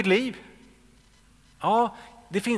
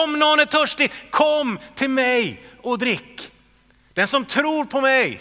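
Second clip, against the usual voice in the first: higher and louder proclaiming; calling out; 7 semitones higher, 5 dB louder than usual. Acted. Preacher retelling Jesus crying out to the crowd. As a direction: call out the invitation to everyone. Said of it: "han ropar".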